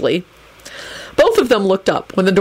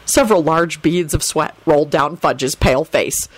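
ordinary delivery

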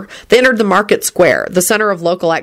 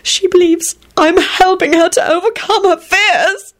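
choked out; 13 semitones higher than usual. A narrator's reading. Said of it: "he choked out".

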